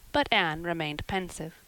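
ordinary delivery, nothing marked

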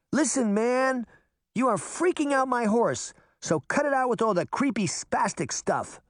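put-on annoyed girl. A narrator's reading. Sabrina tells the spooky rider off, annoyed. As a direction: snap at the creepy rider to cut it out. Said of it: "It also made her mad".

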